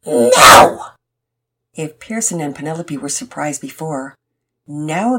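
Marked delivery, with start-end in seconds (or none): 0.0-0.9 explosive, very loud defiant shout; repeated yelled refusal. A narrator's reading. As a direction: bellow it as a stubborn child. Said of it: "and repeated".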